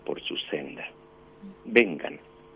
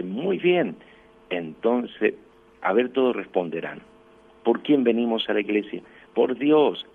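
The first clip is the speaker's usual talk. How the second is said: raised, higher voice rousing a group; retold rallying call; 5 semitones higher, 6 dB louder than usual. Priest rouses communion children to answer together, raised rallying voice. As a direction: re-enact calling children to answer all together.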